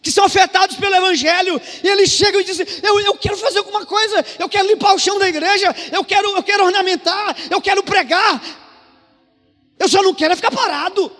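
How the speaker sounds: high, loud preacher's shout; impassioned pulpit cry, sustained at top volume; full-force preaching. Real, not acted. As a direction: drive it home to the whole congregation.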